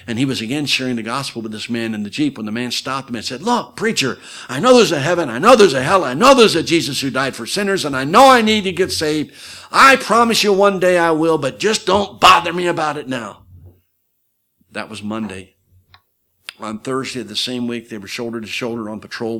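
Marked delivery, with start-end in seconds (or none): 3.4-13.5 loud, high-pitched brush-off, hitting each point hard; exasperated protest. Retold or imitated. Soldier to the preacher in the Jeep, loud exasperated protest. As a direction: push the preacher away, loud and insistent. Man cuts the preacher off impatiently.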